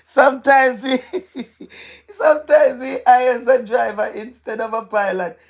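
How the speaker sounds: cracking up mid-sentence; laughing helplessly. Real, not acted. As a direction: try to get the joke out through real laughter. Retries joke while still laughing.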